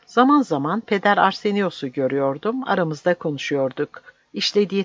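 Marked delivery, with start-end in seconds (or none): none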